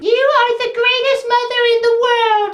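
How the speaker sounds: high put-on child voice; adoring little girl. Girl praises her new mother, in high mock child voice.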